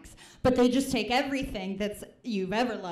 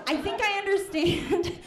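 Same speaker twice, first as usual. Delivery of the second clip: laughing restart; 9 semitones higher than usual. Shown as a demonstration. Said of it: "I think I understand the reason".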